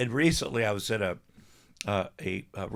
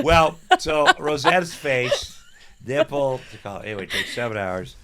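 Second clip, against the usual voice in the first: ordinary talk, nothing marked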